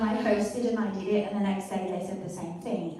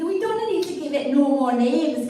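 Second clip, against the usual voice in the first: broad, louder Scottish brogue; Scottish accent; 4 semitones higher, 5 dB louder than usual. Pretend. A proud Scotsman scoffing at other names for Scotch, in a Scottish accent. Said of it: "that's Scottish accent".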